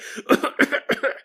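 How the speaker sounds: mock smoke cough from the kids; imitated coughing. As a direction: fake a child's coughing fit. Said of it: "your kids start coughing".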